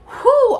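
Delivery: high, loud "whew"; sudden high exclamation of amazement; amazed whew. Real, not acted. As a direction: whoop out an impressed whew. Reacts to valley of clear quartz.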